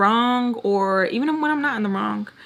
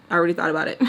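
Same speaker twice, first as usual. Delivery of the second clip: normal talking voice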